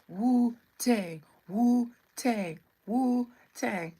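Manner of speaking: loud mock-Chinese chant, each word falling; mock Chinese accent. Streamer greeting a Wu-Tang fan, mock Chinese accent. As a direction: chant it like a movie imitation of Chinese speakers. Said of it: "saying it like Chinese people".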